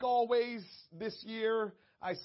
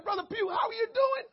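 shouted much higher, crying out; excited shout; 8 dB louder than usual. Retold or imitated. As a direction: yell the question with glee. Asks him a second time.

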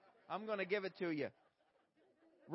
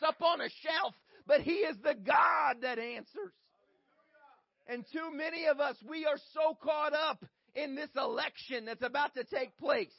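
rising impassioned oratory; 6 semitones higher, 9 dB louder than usual. Acted. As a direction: build the sermon to a shouting peak. Preaching at full force.

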